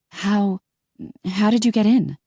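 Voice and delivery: high, frightened stammer. A narrator's reading. Startled by intruder.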